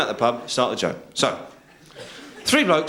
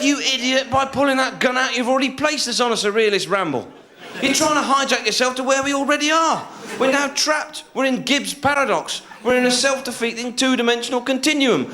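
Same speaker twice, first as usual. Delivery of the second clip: high-pitched, relentless indignant tirade; hammed-up despairing rant; exasperated rant; 12 semitones higher than usual. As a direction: berate the hijacker, pitch held up. Acted row escalating into absurdity.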